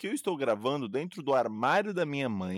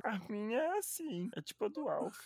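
Alf voice; 8 semitones higher, 6 dB quieter than usual. Shown as a demonstration. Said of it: "Como é que seria a sua voz de atendente de telesexo".

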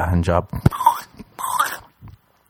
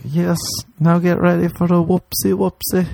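high teasing whoopsie; cutesy baby talk; 10 semitones higher than usual. Pretend. Telling the girl, high cutesy baby talk. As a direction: promise it sweetly.

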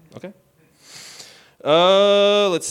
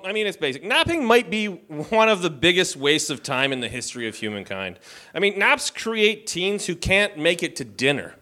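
ordinary talk

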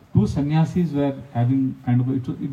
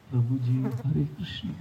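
soft, meek and much quieter, a put-on humble voice; mock humble monk; 5 semitones lower, 7 dB quieter, slower than usual. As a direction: coo it like an overly pious monk. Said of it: "or a tone in the voice".

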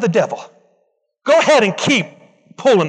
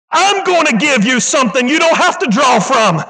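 climbs and ends in a much higher shout; building preacher shout; 5 semitones higher than usual. Acted. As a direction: lift the promise into a shout. Preacher voicing Jesus' promise.

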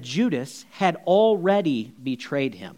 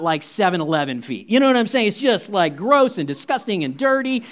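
normal talking voice

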